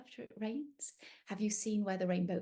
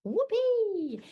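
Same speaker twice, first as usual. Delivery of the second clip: high, loud whoop; party whoop; 12 semitones higher, 8 dB louder than usual. Acted. Unicorns partying, high loud whoop. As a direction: whoop with delight. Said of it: "It's party time".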